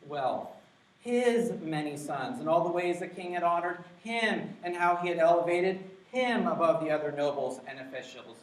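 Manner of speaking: deliberate emphatic scripture reading; emphasized reading. Shown as a demonstration. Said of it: "I'm gonna kinda emphasize certain words here".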